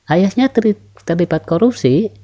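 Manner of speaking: "Ayahnya terli-, terlibat korupsi" high-pitched, as a yes-no question; rising question intonation. Shown as a demonstration. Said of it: "contoh intonasi naik".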